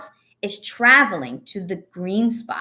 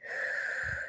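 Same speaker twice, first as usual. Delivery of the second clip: long soft breath out; slow deep exhale; 13 dB quieter than usual. Shown as a demonstration. Teacher showing children a calming breath, soft sigh. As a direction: let the air go slowly. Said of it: "I'm gonna take some deep breaths".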